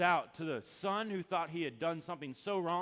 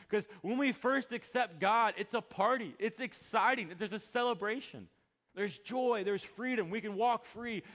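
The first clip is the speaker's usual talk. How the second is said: higher, louder joyful preaching; excited preaching; 7 semitones higher, 7 dB louder than usual. Acted. Pastor describes kids' party, excited preaching. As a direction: celebrate it from the pulpit.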